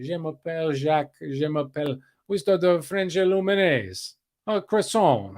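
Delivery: hammed-up mock French accent; bad French accent. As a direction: put on a cartoon French accent for laughs. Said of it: "I should have practiced my French, uh, accent".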